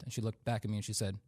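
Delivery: ordinary talk, nothing marked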